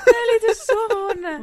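high, slow words through laughter; barely holding it together; giggling denial; laughing. Real, not acted. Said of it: "mä oon naurattanut".

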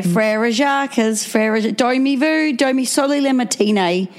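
Australian accent on the French lyric; Aussie-accented French. Shown as a demonstration. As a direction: sing Frère Jacques in a broad Australian accent. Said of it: "So we all say".

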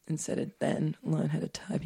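ordinary talk

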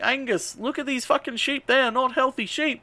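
high-pitched, hammed-up complaint; mock alarmed protest. Surrounded characters protesting to Angus, mock alarm. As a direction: imitate an indignant protest, pitched well up.

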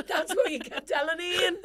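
high, squeaky laughing tease. Real, not acted. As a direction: say it through rising giggles. Teasing co-host, breaking into laughter.

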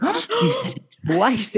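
very high, drawn-out scandalized squeal; mock shocked. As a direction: squeak it slowly in mock horror. Retold shocked reply to mom.

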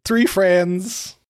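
high delighted surprise; realizing with a jolt; surprised. Real, not acted. Excited realization.

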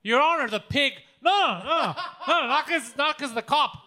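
hammed-up protest with laughter breaking in; mock courtroom plea. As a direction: plead a silly case, half laughing. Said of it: "Did you say that to the judge?".

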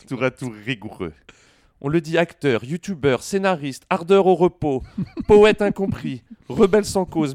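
written tribute read out theatrically; present a co-host with mock grandeur. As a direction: build a teasing portrait, phrase by phrase. Lit l'intro écrite pour son coéquipier. (présentation déclamée, pompeuse et taquine)

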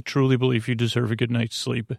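ordinary talk, nothing marked

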